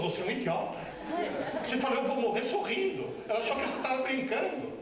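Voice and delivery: high, exasperated wife voice; high mock-scolding wife. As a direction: berate him as the fed-up wife, pitched high. Wife scolding him afterwards.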